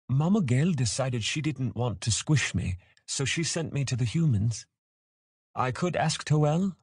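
put-on high childlike voice; young dragon, unsure. A narrator's reading. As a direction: offer it innocently, like a little kid.

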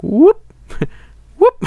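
quick rising cartoon whoop; high whoop noise. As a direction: pop out a high little whoop. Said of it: "be like".

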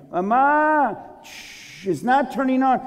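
high, whiny call; kid calling mama. Retold or imitated. Retells calling to his mother.

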